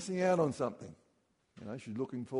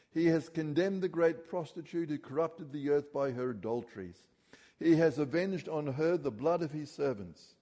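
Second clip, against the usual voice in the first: raised, proclaiming Bible reading; scripture reading; 3 semitones higher, 3 dB louder than usual. Acted. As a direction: read it out like a herald to the congregation.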